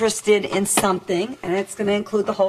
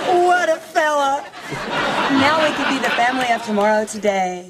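exaggerated sitcom mom; 4 semitones higher than usual. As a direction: coo over your perfect husband. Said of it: "are you sure you want it that exaggerated".

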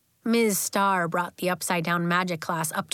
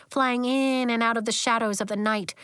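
ordinary delivery

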